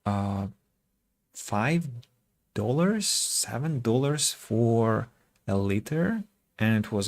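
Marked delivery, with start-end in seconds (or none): none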